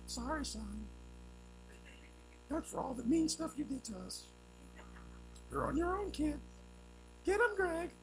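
lightly voiced sisters cheering for the enemy; mock sisters. Sisters tease their little brother during his fight, lightly mocking. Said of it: "I think they was like this".

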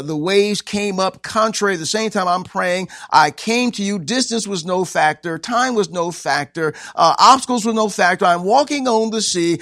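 high, building pulpit proclamation; preaching as Jesus. Acted. Jesus recounting his walk to the disciples, rising preached proclamation. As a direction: build steadily in height and force.

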